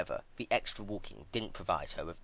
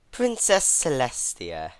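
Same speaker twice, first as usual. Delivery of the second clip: drawn-out, high, louder teasing call of her name; 6 semitones higher, 8 dB louder, slower than usual. Acted. Twilight calling from afar to her captive, loud teasing call. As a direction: sing out her name to taunt the prisoner. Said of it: "a mare's loud, teasing voice".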